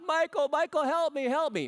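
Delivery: high, loud imitated plea to a husband; crying out for help. Woman stuck with huge luggage calling her husband, high loud mock plea. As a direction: call after him like you're stuck and desperate. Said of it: "she's just crying out".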